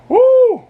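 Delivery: high, loud whoop of delight; excited whoop. Real, not acted. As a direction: cheer out loud. Cheering the fight.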